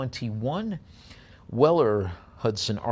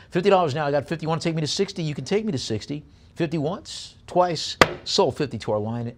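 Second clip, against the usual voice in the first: brisk auction chant, pushing for bids; auctioneer bid call; 6 semitones higher, 5 dB louder, faster than usual. Real, not acted.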